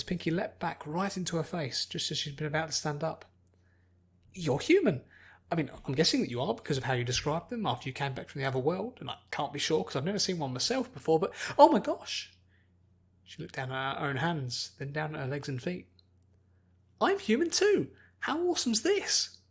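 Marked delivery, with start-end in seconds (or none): 4.3-12.3 put-on a giddy girl babbling with delight; bubbly Pinkie. 17.0-19.4 very high, loud squeal of joy; a girl overjoyed at her new hands; thrilled Pinkie. Acted.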